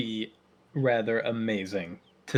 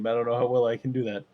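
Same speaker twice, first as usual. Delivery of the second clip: normal talking voice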